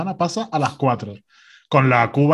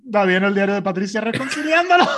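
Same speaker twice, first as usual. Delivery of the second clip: usual speaking voice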